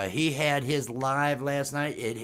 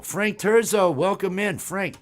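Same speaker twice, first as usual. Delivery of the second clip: hype welcome; 6 semitones higher, 5 dB louder than usual. Real, not acted. Host greeting viewer.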